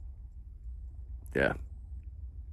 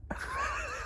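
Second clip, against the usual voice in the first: squealing laugh; 14 dB quieter than usual. Real, not acted.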